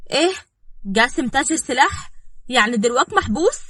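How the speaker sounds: high, loud disbelieving questions; shocked. Acted. Girl learning Qasem is jailed for arms, shocked. Ruqayya hears shocking arrest news.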